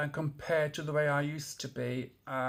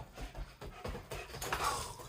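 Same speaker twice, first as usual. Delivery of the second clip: weary sigh; 10 dB quieter than usual. Real, not acted. Exasperated by the dog.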